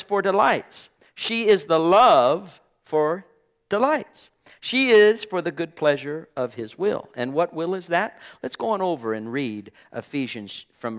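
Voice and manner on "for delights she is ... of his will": higher and louder, lifting on "delights"; fervent reading. Shown as a demonstration. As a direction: proclaim the verse with rising joy. Reading verse with fervour.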